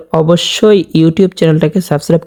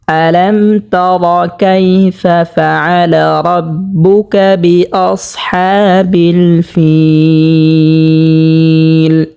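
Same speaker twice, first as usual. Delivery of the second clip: slow, melodic Quran chant; full verse recitation; much slower than usual. Shown as a demonstration. Teacher reciting the full verse for viewers, melodic chant. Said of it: "পুরো আয়াত শুরু থেকে শেষ পর্যন্ত পড়ব".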